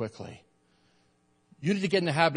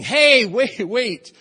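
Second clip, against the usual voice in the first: high, loud call after departing guests; 8 semitones higher, 8 dB louder, much slower than usual. Retold or imitated. Pastor retelling chasing students across the parking lot, calling out loud. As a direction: shout to stop them leaving. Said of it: "and saying".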